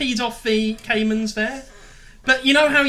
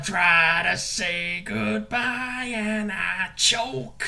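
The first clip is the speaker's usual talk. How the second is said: gravelly voice; 3 semitones lower, 4 dB quieter, much slower than usual. Acted. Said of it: "I can do the really gravelly voice".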